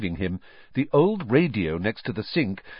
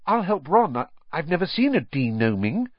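higher, keen volunteering; eager, quick offer; 7 semitones higher than usual. A narrator's reading. Boy volunteering to help his friend, eager. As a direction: jump in keenly to help. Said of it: "said quickly".

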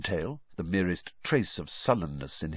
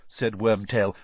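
ordinary delivery

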